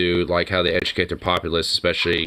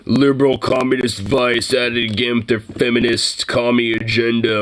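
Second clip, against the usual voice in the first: lightly voiced sneering reactionary commenter; a hint of a scoffing troll reading Vice; mock commenter; 3 semitones higher than usual. Podcaster mocking a reactionary commenter, lightly sneering. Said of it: "Vice still look at that and be like".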